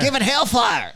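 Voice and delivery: high, full-volume shouted order; hammed-up trigger-happy command; mock battle cry. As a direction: yell it like an attack order. Mocking America's panic at UFOs.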